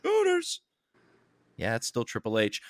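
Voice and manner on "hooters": slow booming ring announcer intro. Acted. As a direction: announce him like a wrestling entrance.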